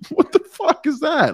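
high, laughing disbelief. Real, not acted. Laughing reaction to quote.

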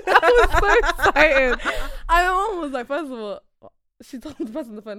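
ordinary talk, nothing marked

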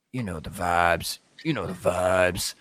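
deep put-on sign-off voice; low catchphrase voice. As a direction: drop into a low cool voice.

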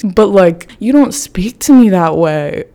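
usual speaking voice

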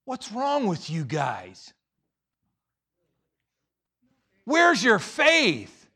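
slow, high, loud challenge; stern rebuke. Acted. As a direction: ring it out as an exasperated reproof. Acting Jesus rebuking the disciples.

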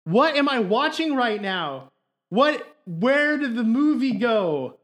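utterly thrown, playing it up; mock bewilderment. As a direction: act out losing the plot of a film. Acted baffled reaction to the film.

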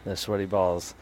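low, soft imitation of quiet talkers; hushed quoting. Quoting a bit about Christmas balls, talking all quiet. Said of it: "they're talking all quiet".

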